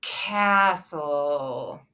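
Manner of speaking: CASTLE drawn out very slowly, each sound held; slow-motion Castle, sounds sliding together; stretched out. Shown as a demonstration. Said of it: "Let's stretch it out".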